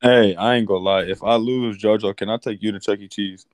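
normal talking voice

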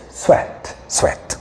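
whispered echo of the slogan; fading echo. Retold or imitated. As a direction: let it fade away like an echo.

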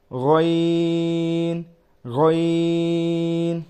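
গইন drawn very long from the throat; throat letter. Shown as a demonstration. Teacher demonstrating গইন slowly. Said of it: "গইন হলকের শেষ হইতে".

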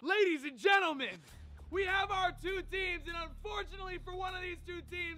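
announcer voice. Acted. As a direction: announce it to a crowd. Host announces the two teams.

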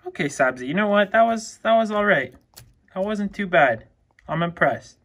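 loud, high, impressed praise; thrilled pet owner praising loudly; excited praise. Real, not acted. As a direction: cheer the lizard's good job. Praising lizard after feeding.

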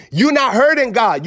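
ordinary delivery

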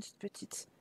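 whispered coo. Real, not acted. Cooing over the child.

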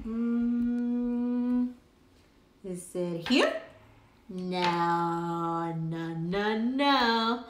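ordinary talk, nothing marked

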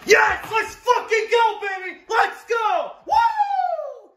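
real elated yelling at the draft pick; wild celebration shouts; ecstatic shouting. Real, not acted. His team drafts Najee Harris.

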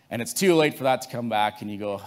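ordinary delivery

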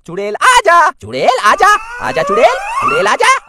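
mock the summons, voice climbing up high; mocking witch call, rising high. Thief mocks the boy calling the witch, voice rising high. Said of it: "मैं कहता हूँ".

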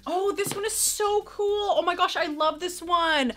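high, stretched-out admiration; gushing delight. Real, not acted. Vlogger admiring a gifted shirt, gushing delight.

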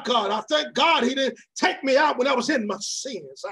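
ordinary talk

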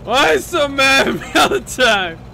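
high, loud aggrieved shout; drunken yelling. Acted. Drunk girl confronts old friend, aggrieved yelling. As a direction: yell the complaint out. Drunk character yells her complaint.

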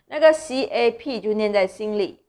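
ordinary talk

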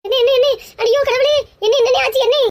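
alarmed shout. Acted. She cries out, alarmed, at the injury. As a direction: cry out in alarm.

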